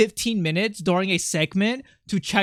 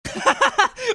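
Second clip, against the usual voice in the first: burst of laughter. Real, not acted. Shocked at own voice clip.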